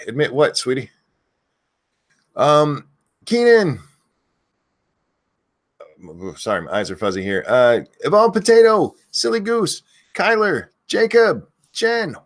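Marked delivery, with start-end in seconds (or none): 3.2-3.8 high, loud shout of the name; screaming a name. Real, not acted. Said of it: "If you want me to scream your name". 8.0-12.1 screaming a name. Real, not acted. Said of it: "If you want me to scream your name".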